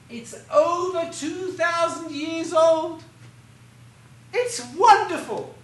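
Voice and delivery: high, loud, slow guide exclaiming; excited tour guide. Retold or imitated. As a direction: proclaim it like an eager guide. Tour guide enthusing to tourists.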